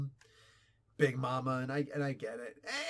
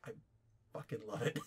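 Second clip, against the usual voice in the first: quiet aside; 6 semitones lower, 9 dB quieter, faster than usual. Retold or imitated. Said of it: "I'm like".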